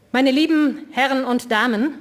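speech opening. A narrator's reading. Liborius opens the club meeting.

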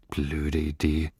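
soft, slow near-whisper. A narrator's reading. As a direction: mutter it slowly under your breath. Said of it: "flüsterte Lilly". (leise und langsam geflüstert)

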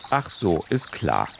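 low fatherly grumble; grumbling. A narrator's reading. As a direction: grumble it, dropping low in disbelief. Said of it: "brummte Sinans Vater". (tief und ungläubig gebrummt)

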